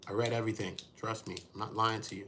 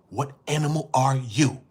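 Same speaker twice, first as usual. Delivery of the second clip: louder, higher, pushing the question; loud challenge; 4 semitones higher, 9 dB louder than usual. Real, not acted. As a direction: call it out hard to the chat. Air animal challenge, shouted.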